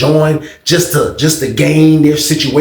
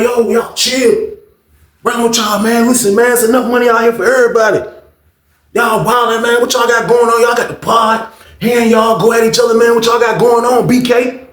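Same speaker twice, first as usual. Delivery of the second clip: high-pitched, exasperated peacemaking; urgent retold plea; 8 semitones higher than usual. Homies breaking up two inmates' quarrel, high and urgent. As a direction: plead high for everyone to settle.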